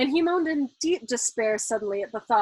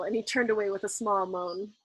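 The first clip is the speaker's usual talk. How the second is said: ordinary delivery, nothing marked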